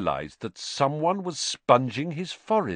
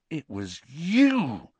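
slow, dawning suspicion; Harry slowly seeing the truth; 5 semitones higher, much slower than usual. A narrator's reading. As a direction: let the realisation sink in. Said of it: "he said slowly".

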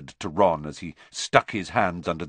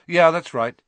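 offhand; 9 semitones higher, 7 dB louder than usual. Acted.

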